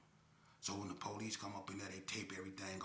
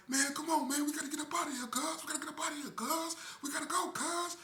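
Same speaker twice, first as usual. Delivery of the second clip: much higher, louder frantic voice; panicked urging; 7 dB louder than usual. Retold or imitated. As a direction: hurry him out in panic. Accomplice urging escape, panicking.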